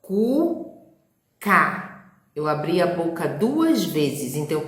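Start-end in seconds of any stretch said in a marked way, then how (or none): 0.0-1.8 Cuca said in two clear beats, Cu and ca; syllable demo. Shown as a demonstration. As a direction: open the mouth twice on Cuca. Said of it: "de acordo com quantas vezes eu pronuncio".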